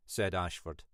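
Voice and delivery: usual speaking voice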